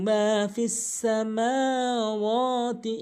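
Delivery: মা and ওয়া held one alif, slow chant; stretched ma. Shown as a demonstration. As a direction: let মা ring long. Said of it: "মিম এবং ওয়াও এক আলিফ করে টানবেন". (মা ও ওয়াও এক আলিফ টেনে)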